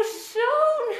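usual speaking voice

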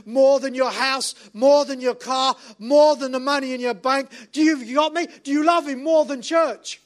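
loud, driving list of challenges; impassioned preaching. Acted. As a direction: press the congregation harder with each question. Preaches at full force.

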